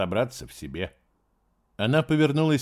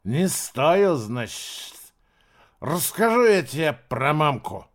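put-on drunken wild jeer, higher; 5 semitones higher, 3 dB louder, slower than usual. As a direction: snarl it with a drunk smirk. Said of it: "Он диковато усмехнулся".

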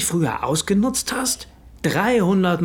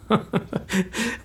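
short, scornful laugh at his victims; smug laugh; 6 semitones higher, 5 dB quieter than usual. Acted. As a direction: chuckle with contempt at the frightened men. Becker bragging about the extortion.

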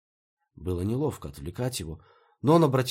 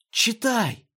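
loud, drawn-out high command; urgent cry; 6 dB louder than usual. A narrator's reading. Maya demands that Peter read the names, urgent cry. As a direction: cry it out as an impatient order.

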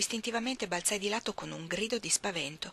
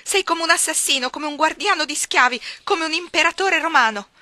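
much higher, much louder accusing shout; 9 semitones higher, 11 dB louder than usual. Acted. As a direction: hurl the accusations at him. (grido di accusa altissimo)

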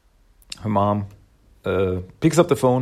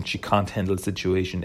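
ordinary delivery

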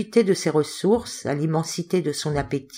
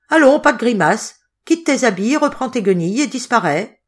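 fierce, raised-pitch commands; 5 semitones higher, 4 dB louder than usual. A narrator's reading. Hotelier ordering the crying boy to change and go, fierce. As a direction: order him out savagely, loud at first. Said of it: "ajouta avec un accent farouche".

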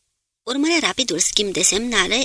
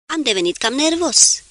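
higher-pitched apologetic exclamation; exclamație de scuză; 6 semitones higher, 3 dB louder than usual. A narrator's reading. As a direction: blurt a mild apology. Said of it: "exclamă el scuzându-se". (exclamație ușoară, ca o scuză)

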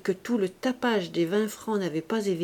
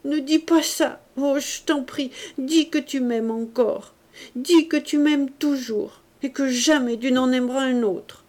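tearful pleading; 6 semitones higher, 5 dB louder than usual. A narrator's reading. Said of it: "ses yeux s'emplirent de larmes".

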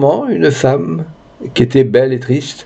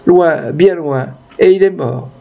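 high, plaintive lament; high-pitched acted grief, no real sobbing; tearful old lady; 6 semitones higher than usual. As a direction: mourn a son as a weeping old woman. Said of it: "dit une vieille dame qui pleurait".

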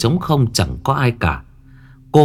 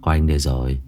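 lower, softer comforting husband; reassuring; 5 semitones lower, 3 dB quieter than usual. A narrator's reading. Husband comforting frightened wife, low soft reassurance. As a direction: soothe her, low and soft. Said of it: "Thuận vỗ về an ủi vợ".

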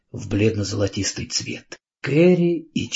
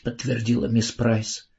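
usual speaking voice

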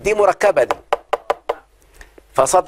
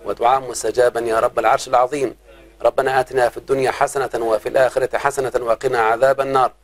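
low, steady prayer voice; closing prayer; 4 semitones lower than usual. Real, not acted. Teacher closes the lesson with a supplication, low. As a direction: pray over the class, low and even.

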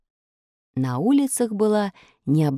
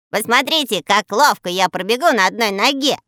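boasting loudly; 4 semitones higher, 4 dB louder than usual. Acted. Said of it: "Обратился он громко".

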